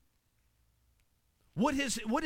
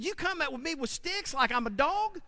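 high, scornful taunt; Goliath mocking; 10 semitones higher than usual. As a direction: laugh at the stick. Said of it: "He said".

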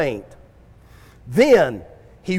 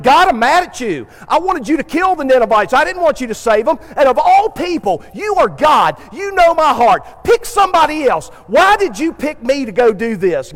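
mad at God; 4 semitones higher than usual. Retold or imitated. Jonah tells God he is mad, raised indignant voice. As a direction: protest hotly to God. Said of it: "He's like".

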